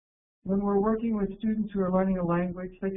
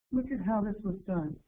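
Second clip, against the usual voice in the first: normal talking voice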